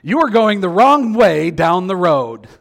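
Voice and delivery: a car navigator yelling a warning; GPS yelling. Retold or imitated. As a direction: bark it like an alarm. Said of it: "I'll say, yells out".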